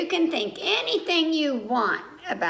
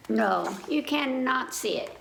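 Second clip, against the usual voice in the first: ordinary delivery, nothing marked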